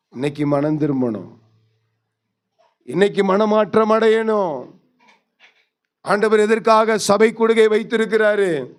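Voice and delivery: ordinary talk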